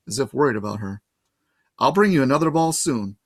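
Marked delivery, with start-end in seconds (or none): none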